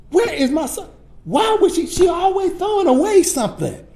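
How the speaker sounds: high-pitched heated outburst rising to a shout; getting heated. Retold or imitated. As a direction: blow up in frustration about the salsa. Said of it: "I'm getting heated".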